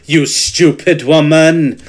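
slow, drawn-out stage French scolding; mock French accent. Co-host quotes a sitcom catchphrase, slow French accent. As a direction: scold slowly in comic French. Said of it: "hello levels of racist".

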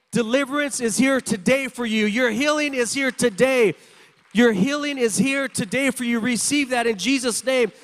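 rising preacher's exhortation; fervent sermon delivery; preaching. Acted. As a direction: preach hope to the congregation. Preaching at full force.

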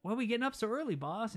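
high-pitched imitation of a complaint; henchman's whine. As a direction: whine up high like a mock sidekick. Gang member complains to boss.

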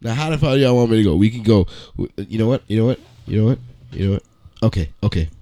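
ordinary delivery

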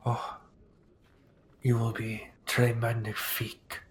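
put-on soft, cooing French accent. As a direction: purr it in French. Seamstress coos in French.

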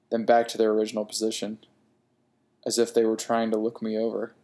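usual speaking voice

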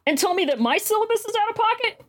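indignant, high-pitched incredulous rant; still mad. Real, not acted. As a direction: vent in high-pitched disbelief at being blamed. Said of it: "I'm still fucking mad about this".

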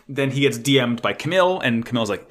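ordinary delivery

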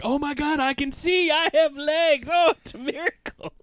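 high, loud mock amazement; miracle cry. Fake blind vet caught by cops, hammed-up miracle shout. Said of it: "And he's like".

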